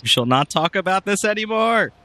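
dramatic decree. Acted.

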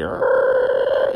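a loud breathy creaking-door groan; creaking-joint groan. Retold or imitated. People in their thirties standing up, a loud breathy creaking groan. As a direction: make the noise of a body creaking as it stands. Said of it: "they sound like a creaking door".

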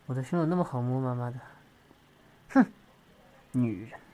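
low, grumbling brush-off with mild swearing; gruff annoyed partner. Acted.

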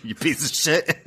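cracking up while insulting; laughing mock insult. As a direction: joke insult tossed out mid-laugh. Adds joke insult while laughing.

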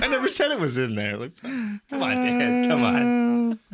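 high, mock-whiny objection; exasperated protest. Acted protest against friend's correction.

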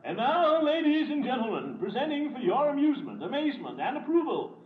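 high booming ringmaster announcing; ringmaster announcement. Acted. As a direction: announce it grandly to the big top. Ringmaster presents the next act.

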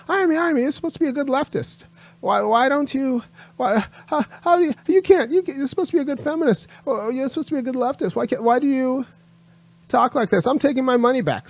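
high whiny mock voice of an upset supporter; whiny sarcastic impression of a fragile fan pulling money; mocking offended donor. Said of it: "get really in their feelings 'cause they assume".

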